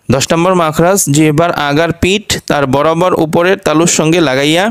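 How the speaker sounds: normal talking voice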